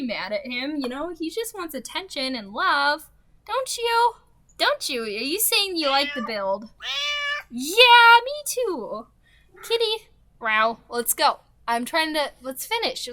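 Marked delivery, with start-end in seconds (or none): 3.5-10.1 higher cooing voice; doting pet talk. Real, not acted. Calling her cat her little baby, higher doting pet voice. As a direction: coo over the cat.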